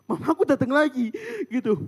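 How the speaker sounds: ordinary talk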